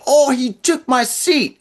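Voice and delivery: high, drawn-out indignant cry, imitating her; mock yell. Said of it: "said with a huge voice, like, she, she yelled".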